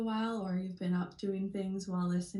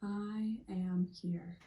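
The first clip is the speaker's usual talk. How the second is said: slow, spaced-out mantra with long silences; meditative affirmation; slower than usual. Shown as a demonstration. Guide repeating an affirmation with listeners ten times, slow meditative mantra. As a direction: say each word slowly, settling into the moment.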